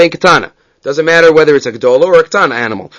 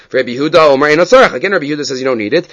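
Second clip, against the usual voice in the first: usual speaking voice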